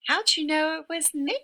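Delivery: high, fast squeaky turkey moan. Acted. Turkey caught in his horse costume, high fast moan. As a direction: whine it like a caught-out bird. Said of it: "moaned Turkey".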